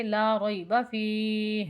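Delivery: فیہ lengthened and closed with a breathed h; slow لا ریب فیہ ending in an audible h; audible final h. Shown as a demonstration. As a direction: draw out فیہ, then let the h sound before stopping. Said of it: "ہاں کی آواز نکالیں گے".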